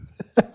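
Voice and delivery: a brief nervous little laugh; a quick laugh before answering; short laugh. Real, not acted.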